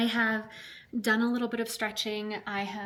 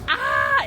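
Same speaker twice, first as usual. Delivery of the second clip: cold yelp; 7 semitones higher, 8 dB louder than usual. Real, not acted. Reacting to freezing car.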